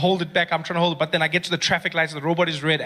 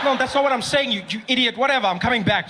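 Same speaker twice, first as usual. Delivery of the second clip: exasperated; 6 semitones higher than usual. Retold or imitated. Exasperated at brother.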